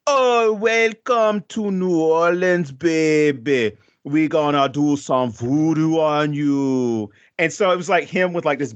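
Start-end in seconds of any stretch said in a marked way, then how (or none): 0.1-7.1 put-on slow, drawn-out movie New Orleans accent, pitched up; a hammed-up movie New Orleans accent, slow and stretched. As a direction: ham up the Hollywood New Orleans accent like a movie voodoo priest. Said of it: "this is how it's depicted in movies".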